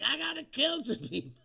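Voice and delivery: a hammed-up crazed gunman; mock killer voice. Mocking the shooter's thinking.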